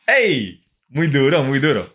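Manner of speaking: ordinary delivery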